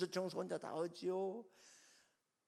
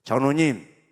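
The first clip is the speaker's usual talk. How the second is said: called out; 3 semitones lower, 18 dB louder, slower than usual. Retold or imitated. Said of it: "그래도".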